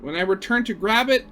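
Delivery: ordinary delivery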